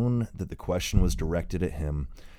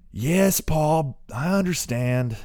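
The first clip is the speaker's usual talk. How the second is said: put-on young man calling back; 12 semitones higher, 7 dB louder, slower than usual. A narrator's reading. Son answering from afar.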